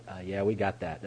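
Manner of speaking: low, soft, underwhelmed deadpan; unimpressed reply. Retold or imitated. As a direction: mimic brothers who already know it all. Brothers' unimpressed reply to his discovery.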